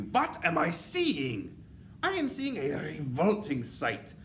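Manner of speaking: loud, high witch rant swelling to a scream; disgusted witch tyrant. A narrator's reading. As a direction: build from outraged complaint to screamed demand. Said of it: "she screamed".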